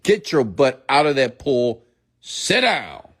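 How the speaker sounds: put-on stern dad ordering a child out; daddy voice. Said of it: "I had to use my daddy voice".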